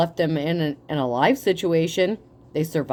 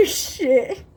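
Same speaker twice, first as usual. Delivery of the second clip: laughing through the word; cracking up mid-line; amused chuckle; 6 semitones higher than usual. Real, not acted. Cracks up at own joke.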